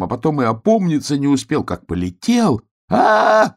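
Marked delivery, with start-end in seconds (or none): 2.9-3.6 high, loud drawn-out "aah"; hedgehog's scream. Acted. Hedgehog lifted by wind, high scream. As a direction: cry out high as you fly off. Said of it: "закричал ёжик".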